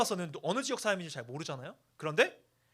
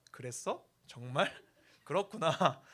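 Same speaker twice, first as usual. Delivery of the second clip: mock Seoul dialect; slower than usual. Shown as a demonstration. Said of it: "라는 단어를 자연스럽게 구사할 수".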